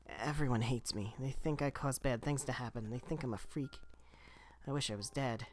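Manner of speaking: put-on soft, hushed despairing girl; softly weeping. A narrator's reading. Kaine telling her grandmother everyone hates her, soft tearful voice. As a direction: let the tears through, barely above a whisper. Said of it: "Kaine's tears turned to sobs".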